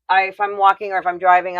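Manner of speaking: usual speaking voice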